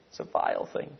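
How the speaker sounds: breathy whisper of disgust, no voice at all; whispered repeat. Acted. Preacher whispering to congregation about leprosy, hushed and unvoiced. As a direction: hiss it out quietly as something vile.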